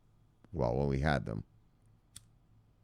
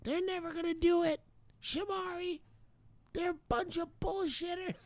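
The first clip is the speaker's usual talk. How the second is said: high falsetto impression of a doubting friend; mock high-pitched voice of a sceptical friend; imitating Bob; 9 dB quieter than usual. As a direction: squeak out Bob's scorn in a tiny far-off voice. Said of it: "Bob doesn't believe".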